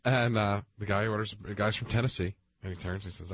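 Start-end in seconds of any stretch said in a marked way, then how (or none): none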